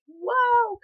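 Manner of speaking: high, drawn-out whoa of realization; mock-startled whoa. Storyteller replays her realization about the boy, mock-startled. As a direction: act out a sudden startled realization.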